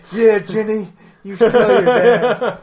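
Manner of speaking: mock voice. Joking reply in put-on voice.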